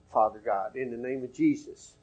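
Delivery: soft, hushed opening of a prayer; quiet prayer. Shown as a demonstration. Preacher showing how he addresses God when praying, softly. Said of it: "that's why I pray to him".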